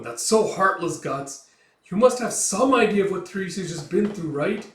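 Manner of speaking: acted outraged protest; indignant scolding. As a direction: take someone to task for heartlessness. Puck scolds Guts for cruelty.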